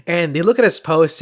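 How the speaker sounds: ordinary talk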